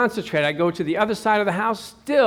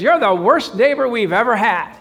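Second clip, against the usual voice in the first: loud, higher scolding; mock angry neighbor; 4 semitones higher, 7 dB louder than usual. Neighbor in her car scolding the preacher, mock angry. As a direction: scold him from the car window. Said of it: "she says".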